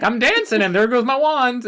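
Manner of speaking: high, careless fairy voice; high mocking airhead voice; mocking ditzy fairy. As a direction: play her airily losing the wand.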